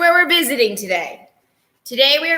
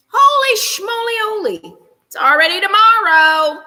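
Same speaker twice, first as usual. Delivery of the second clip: high silly amazed holy schmoly-oly; high goofy amazed holy schmoly-oly; amazed exclaim; 8 semitones higher, 4 dB louder than usual. Real, not acted. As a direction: marvel at it playfully. 1:15 am, already tomorrow.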